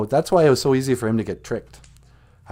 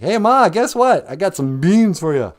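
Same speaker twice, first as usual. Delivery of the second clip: high, loud, proud call; excited announcement; 8 semitones higher, 5 dB louder than usual. Acted. Jack comes home to tell Ma about his beans, excited. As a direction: burst in with great news.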